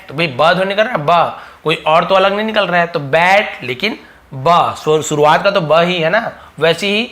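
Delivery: usual speaking voice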